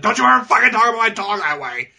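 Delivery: high, fast indignant imitation; mock super-serious outrage. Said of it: "super seriously".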